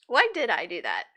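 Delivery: ordinary talk, nothing marked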